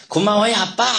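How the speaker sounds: put-on a child's overjoyed shout of thanks; happy cry. A narrator's reading. 아이가 아빠에게 기뻐서 외치는 고마움. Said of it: "하고 소리를 쳤습니다".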